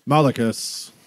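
loud warning call of a father; stern booming Duke. Acted. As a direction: bark the name as a stern warning. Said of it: "big booming voice".

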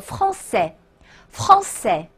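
français drawn out in two clear syllables; slow sounding-out. Shown as a demonstration. Teacher sounding out a new word, slow and syllabic. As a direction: sound out français slowly, "fran" then "çais". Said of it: "请大家自己直接拼读啊".

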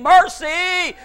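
high, drawn-out plea; crying out. Retold or imitated. Preacher as Canaanite woman pleading for her daughter, crying out. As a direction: call out pleadingly for mercy. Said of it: "Cry out".

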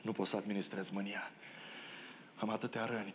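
soft, held-back admission; quiet wounded confession. Acted. Joseph after jailing his brothers, quiet confession of hurt.

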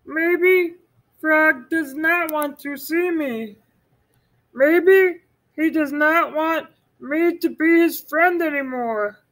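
high, slow worried Toad. Acted. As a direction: fret aloud in a high voice. Toad doubts.

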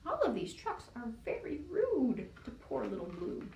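ordinary delivery, nothing marked

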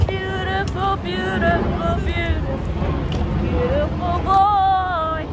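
high, loud, drawn-out gushing; gushing praise. Real, not acted. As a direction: sing his praises slowly. Admiring dressed-up boy.

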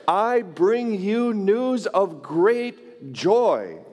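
slow, solemn announcement; angel's proclamation. Retold or imitated. Angel announcing Christmas to shepherds, slow and solemn. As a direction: proclaim it slowly like a herald. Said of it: "The angel showed up ... and said".

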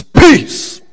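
sudden high, loud command; commanding shout. Acted. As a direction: call it out to still a storm. Jesus commands the storm.

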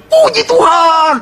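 high, drawn-out yell; exultant shout. Acted. Rider spurring his galloping horse, exultant shout. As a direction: holler it long and high to spur the horse on. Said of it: "teriak puji Tuhan, makin kenceng dia".

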